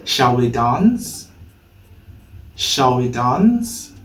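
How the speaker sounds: slow, linked "Shall we dance?" with a weak "we"; slow model sentence. Shown as a demonstration. Teacher modelling the sentence slowly for learners. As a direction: say it slowly so students can copy. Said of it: "Let's put it in a sentence now".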